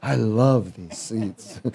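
slow, laughing through the words. Real, not acted. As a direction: chuckle as you repeat it.

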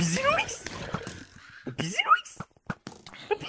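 very high squeaky sing-song call; squeaky high taunt. Pretend. Taunting friend with silly call.

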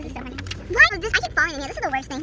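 ordinary talk, nothing marked

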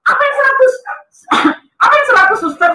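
high-pitched puzzled query; baffled question. Retold or imitated. Puzzled patient asking the nurse, baffled question. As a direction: ask it in bewilderment, voice pitched right up.

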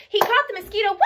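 loud, high burst ending in a whoop; excited catch. Acted. Telling children the mosquito got caught, excited catch. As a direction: pounce on it with a whoop.

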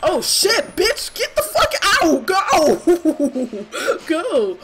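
high, loud frantic shouting at the monster; panicked yelling. Real, not acted. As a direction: yell in pure panic to drive it off.

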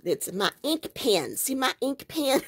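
exaggerated country twang; mock Southern accent. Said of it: "I'm making fun of y'all".

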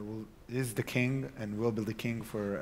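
ordinary talk

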